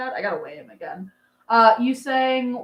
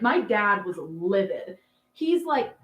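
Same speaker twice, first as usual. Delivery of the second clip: usual speaking voice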